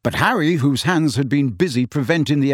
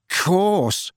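bitter; 6 semitones higher than usual. A narrator's reading. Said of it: "said Ron bitterly".

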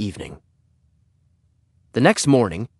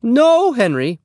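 very high, loud, drawn refusal; Missus Plummer; 13 semitones higher, 6 dB louder, slower than usual. Acted. Neighbor turns the boy down, high and emphatic. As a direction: sing out the no from up high.

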